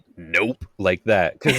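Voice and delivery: usual speaking voice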